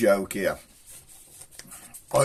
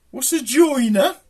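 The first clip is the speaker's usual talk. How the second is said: high, slow, drawn-out question; puzzled woman; 14 semitones higher, 6 dB louder than usual. Retold or imitated. One sister baffled by the other, high slow query. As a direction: pitch it right up in bafflement. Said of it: "two women talking the other day".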